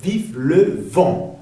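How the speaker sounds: "Vive le vent" very slow, word by word; spaced-out French model of "Vive le vent"; pronunciation demo. Shown as a demonstration. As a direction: end "Vive le vent" on a nasal vowel. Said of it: "sonido nasal".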